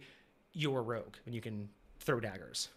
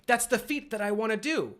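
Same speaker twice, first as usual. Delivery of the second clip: a burst of mock excitement, pitched higher and louder; excited wish; 8 semitones higher, 6 dB louder than usual. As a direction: gush like a player who just saw a viral trick shot. Player dreaming of a trick shot.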